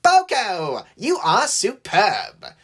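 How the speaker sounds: slow, high, overdone praise; drawn-out, showy admiration; gushing flattery. A narrator's reading. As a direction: lay the compliment on thick. James gushes at Boko to prove himself.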